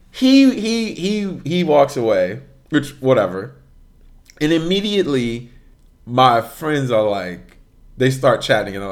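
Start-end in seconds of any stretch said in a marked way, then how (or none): none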